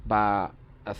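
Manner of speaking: normal talking voice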